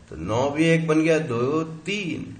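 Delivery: ordinary talk, nothing marked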